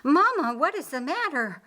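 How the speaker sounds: a high, loud frightened cry for her mother; kneeling by her fainted mother, calling in alarm; worried daughter. A narrator's reading.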